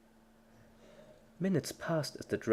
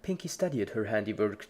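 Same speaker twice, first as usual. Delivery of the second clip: plain reading voice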